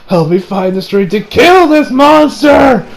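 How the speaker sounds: mock prayer building to a shout; impassioned vow. Boy praying for strength to kill the monster, acted impassioned vow. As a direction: start pleading, then stretch and shout the ending.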